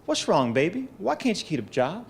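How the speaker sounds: a hint of a worried grandmother's North Carolina accent, rising; fond imitation of a fretting North Carolina grandma, voice lifting; imitating grandma's twang. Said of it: "In her North Carolina twang, she would say with pain in her voice".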